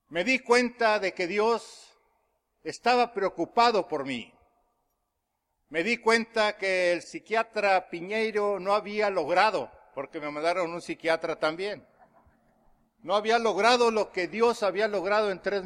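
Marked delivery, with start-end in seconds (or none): none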